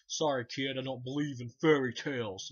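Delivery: a hint of Freddy mocking the kid; Freddy line. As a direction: sneer it like the horror villain.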